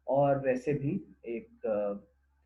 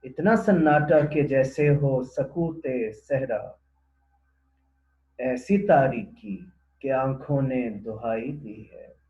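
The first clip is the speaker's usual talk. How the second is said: loud declaimed verse, easing toward the end; poem recitation; 10 dB louder than usual. Acted. Presenter repeating the couplet before explaining it, poem recitation. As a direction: recite in measured, spaced phrases.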